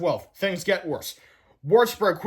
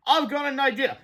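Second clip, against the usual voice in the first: higher, eager character voice; excited idea; 8 semitones higher than usual. A narrator's reading. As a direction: announce a bright idea. George announces idea to friend.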